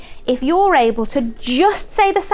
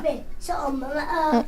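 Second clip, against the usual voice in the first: ordinary talk, nothing marked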